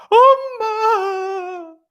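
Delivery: imitating a wailing child. Daughter ignoring dad's comfort, wailing drawn out for mom. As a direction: stretch out a crying child's wail. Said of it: "눈을 꽉 감고 울고 있는 거예요".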